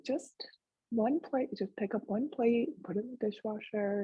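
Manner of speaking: soft, high, coaxing inner voice; kind encouragement. Retold or imitated. Benevolent voice nudging her toward the dishwasher, softly encouraging. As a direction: gently talk yourself into a small chore. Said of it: "voice of encouragement was there".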